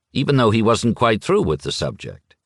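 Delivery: usual speaking voice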